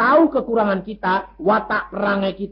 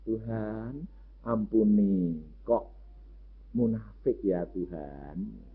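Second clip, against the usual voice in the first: hushed prayer; 9 semitones lower, 12 dB quieter, slower than usual. Retold or imitated. Quiet prayer about hypocrisy.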